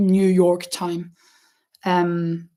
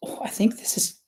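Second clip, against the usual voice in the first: whispered aside; 4 dB quieter, faster than usual. Real, not acted. Aside while adjusting the camera.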